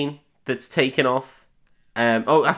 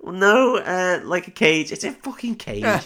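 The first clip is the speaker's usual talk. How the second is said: much higher, exasperated burst; 8 semitones higher, slower than usual. Real, not acted. Friend finally remembering the word cage, exasperated. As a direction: blurt out the word at last.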